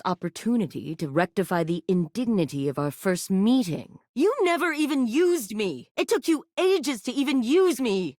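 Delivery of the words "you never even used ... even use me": higher aggrieved plea; hurt complaint. Acted. Toy reproaching the player for neglect, hurt higher voice. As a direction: raise the pitch, wounded and upset.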